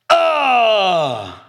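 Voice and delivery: loud, high wail of regret; anguished cry. Pretend. Preacher acting out giving in to temptation, anguished cry. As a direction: cry out like you just gave in. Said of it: "And you're like".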